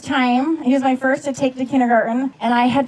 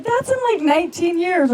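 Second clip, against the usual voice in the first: much higher-pitched retold protest; mock incredulous; 7 semitones higher than usual. Husband answers his worried wife, high mock incredulity.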